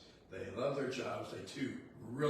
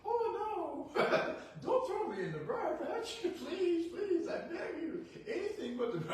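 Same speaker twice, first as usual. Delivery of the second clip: much higher, laughing, mock-desperate begging; pleading rabbit; 3 dB louder than usual. Rabbit begging the farmer not to throw him, hammed-up high plea. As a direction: ham up a sly rabbit pretending to beg. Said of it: "bear rabbit goes".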